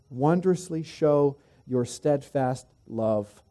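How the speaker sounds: slow, weighty reading of "Wondrously show your steadfast love"; emphasized phrase. Shown as a demonstration. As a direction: lean on "Wondrously", drawn out. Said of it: "look at this phrase".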